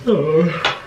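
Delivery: usual speaking voice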